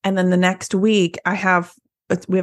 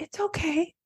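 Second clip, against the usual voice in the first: a lightly voiced sweet client, higher and gentle; 6 semitones higher than usual. Kind salon clients telling the stylist to wash them cold, lightly voiced gentle reassurance. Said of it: "my sweet clients".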